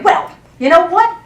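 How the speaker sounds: hammed-up indignant comeback; mock defensive protest. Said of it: "I'm not sitting there going".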